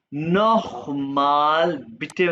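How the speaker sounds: slowly spelled out नोखमाल बिटे; slow down so students catch नोखमाल; slow teaching delivery. Shown as a demonstration. Teacher slowly models a phrase for students. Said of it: "तो आप मुझे कह सकते हैं".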